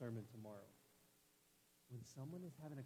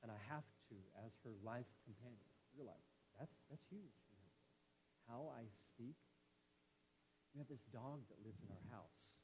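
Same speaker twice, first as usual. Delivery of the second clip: ordinary talk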